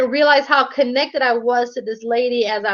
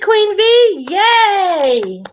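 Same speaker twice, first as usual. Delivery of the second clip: happy cheer; 7 semitones higher, 4 dB louder, much slower than usual. Real, not acted. Welcoming someone back in.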